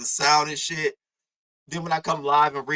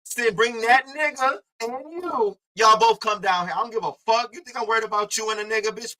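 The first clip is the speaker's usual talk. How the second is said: higher-pitched, taunting reenactment; retold defiant challenge; 7 semitones higher than usual. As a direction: act out daring them to show up. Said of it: "I went live and I said".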